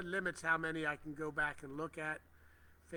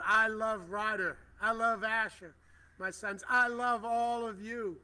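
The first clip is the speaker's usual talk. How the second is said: higher and louder, slowly and fervently; heartfelt declaration; 5 semitones higher, 8 dB louder, slower than usual. Real, not acted. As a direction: proclaim your love for your sons. Declares love for her sons.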